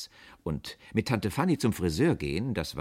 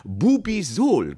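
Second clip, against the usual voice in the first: loud, high, drawn-out name; proud product presentation; 11 semitones higher, 10 dB louder, much slower than usual. A narrator's reading. Boss showing crystal bottles, proudly proclaiming. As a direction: announce the brand name grandly.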